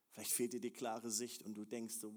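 usual speaking voice

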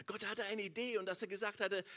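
ordinary talk

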